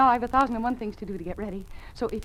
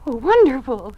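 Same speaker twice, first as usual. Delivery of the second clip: delighted; 4 semitones higher, 11 dB louder, slower than usual. Acted. Ann hears of her appointment.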